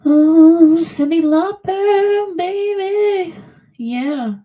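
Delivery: high, loud and drawn out; gushing over a favorite singer; excited. Real, not acted. Excited about eighties pop.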